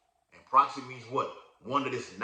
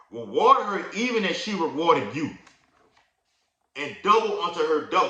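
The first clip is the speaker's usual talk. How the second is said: loud, raised scripture reading; preaching scripture; 5 semitones higher, 6 dB louder than usual. Acted. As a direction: proclaim the verse to the congregation. Reads scripture aloud, preaching.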